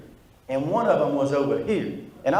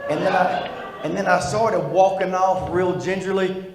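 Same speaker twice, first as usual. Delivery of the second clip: normal talking voice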